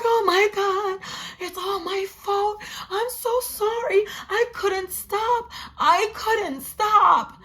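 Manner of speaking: high, drawn-out hammed-up wail; mock sobbing. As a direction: imitate her hysterical crying, slow and whiny. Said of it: "the girl is just crying uncontrollably".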